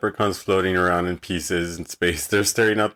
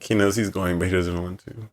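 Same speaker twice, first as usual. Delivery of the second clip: ordinary talk, nothing marked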